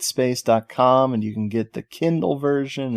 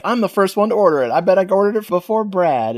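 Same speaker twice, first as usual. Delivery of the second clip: higher, rushed mock brag; imitating Scott; 7 semitones higher, much faster than usual. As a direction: blurt it out fast and high, in imitation. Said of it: "Scott's like".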